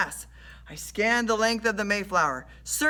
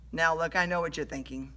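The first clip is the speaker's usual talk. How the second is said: plain reading voice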